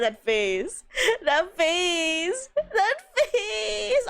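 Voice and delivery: rising, laughing "that face"; laughing disbelief. Real, not acted. Laughing at a friend's model, pitch climbing. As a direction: crack up over it. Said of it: "laughing at the screen".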